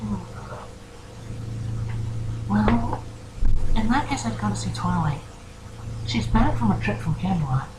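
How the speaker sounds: helpful pony in a high, gentle voice; Fluttershy. Acted. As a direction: advise kindly in a small, high character voice. Voices Fluttershy advising the player.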